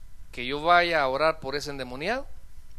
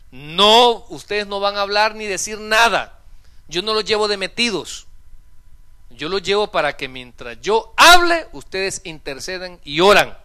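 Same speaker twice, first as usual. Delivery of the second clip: loud, emphatic instructions, pitch raised; stern orders; 3 semitones higher, 10 dB louder than usual. Retold or imitated. Pastor briefs two helpers before an exorcism, loud stern orders. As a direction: lay down the rules forcefully to your helpers.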